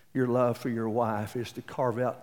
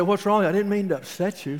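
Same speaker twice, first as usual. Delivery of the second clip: higher and louder, startled worry; alarmed concern; 7 semitones higher, 5 dB louder than usual. Retold or imitated. Husband startled when his wife starts bawling, alarmed. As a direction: rush in worried.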